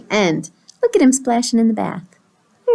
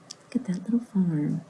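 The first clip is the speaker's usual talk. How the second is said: hushed, unvoiced whisper; whispered aside; 2 semitones lower, 9 dB quieter than usual. Real, not acted. Reader showing the farm picture to children, soft whisper. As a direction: whisper it softly to the listeners.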